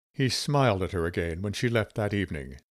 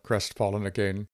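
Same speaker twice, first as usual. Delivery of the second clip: ordinary delivery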